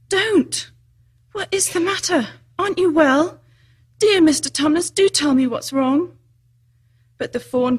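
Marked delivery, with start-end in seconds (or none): 0.1-6.2 high, urgent pleading; great distress. A narrator's reading. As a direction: beg him anxiously, pitch raised. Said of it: "said Lucy in great distress".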